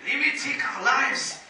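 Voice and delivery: rising into a high, loud, slow Scottish battle cry; butchered Scottish accent. Retold or imitated. Preacher quoting Braveheart to the congregation, hammed-up Scottish accent shout. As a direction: ham up a bad Scottish accent and shout it to the rafters. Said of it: "excuse me as I butcher the Scottish accent".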